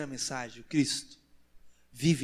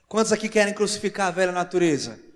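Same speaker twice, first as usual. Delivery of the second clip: loud preacher's question to the crowd; raised, rousing voice; rallying call; 4 semitones higher, 7 dB louder than usual. Real, not acted. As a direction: call out to stir the congregation. Third rallying question to the congregation.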